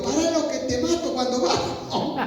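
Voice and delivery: high-pitched mock threat; imitated yelling. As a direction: retell a rider's frantic threat without real volume. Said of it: "le gritaba". (amenaza a gritos imitada, en voz aguda)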